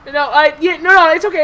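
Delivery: high, loud, stammering rush to agree; frantic backpedalling. Acted. Greedy merchant to customers threatening to leave, frantic. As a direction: hurriedly talk them out of walking away. Merchant scrambles to keep the deal.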